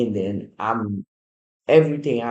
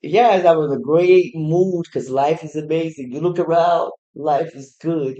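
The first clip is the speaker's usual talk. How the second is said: upbeat positive talk; 4 semitones higher, 4 dB louder than usual. Real, not acted. Upbeat good vibes run.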